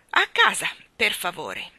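ordine secco e deciso; intimazione. A narrator's reading. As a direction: comanda senza repliche. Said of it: "intimò Mary Poppins". (Mary Poppins intima un ordine)

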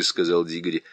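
usual speaking voice

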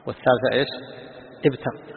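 ordinary talk, nothing marked

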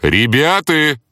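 loud high call to the team. Acted. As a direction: call the team over your shoulder. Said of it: "повысил голос я".

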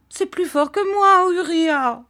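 high, loud outburst; mother's cry. A narrator's reading. Mother crying out to Uriah, high and loud. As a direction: burst out to him. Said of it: "cria sa mère".

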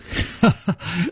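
short chuckle rising at the end; light conceding laugh. A narrator's reading.

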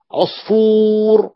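very slow, drawn-out عصفور; slow demo. Shown as a demonstration. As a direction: stretch عصفور so students hear each sound. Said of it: "teacher drawing out example word".

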